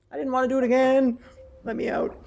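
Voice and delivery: high, loud comic wail; high-pitched playful protest; mock despair. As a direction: plead to escape, hammed-up. Jokey despair at repeating the event.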